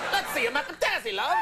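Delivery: high, put-on Aussie catcall; mock Australian accent. Producer calling out in the hallway, mock Australian accent. Host acts out Australian slang line.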